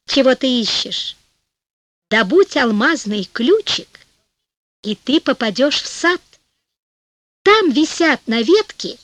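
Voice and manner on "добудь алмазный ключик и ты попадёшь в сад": usual speaking voice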